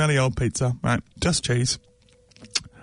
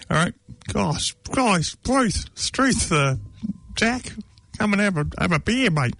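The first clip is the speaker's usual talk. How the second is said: much higher, hammed-up Australian mate voice; mock Aussie bloke; 6 semitones higher than usual. Mocking Australians at Darling Harbour in a high, broad Australian accent. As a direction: caricature a Sydney bloke calling his mate over for a beer, in a broad Aussie accent. Mocking Sydney business mates.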